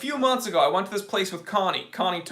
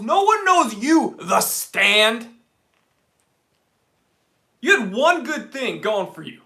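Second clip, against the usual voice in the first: louder mock scolding of the restaurant; mock rant; 5 dB louder than usual. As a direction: scold the burger place with theatrical fury. Ranting at the restaurant.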